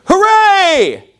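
high, drawn-out cheering hooray; exuberant cheer. Shown as a demonstration. Preacher demonstrating a familiar term to his congregation, exuberant cheer. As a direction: holler it out like a crowd celebrating. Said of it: "who's ever heard this term".

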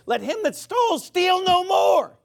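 high, slow, shouted scripture; preacher's shout. Acted. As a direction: thunder the verse at the congregation. Preacher thunders a Bible quote.

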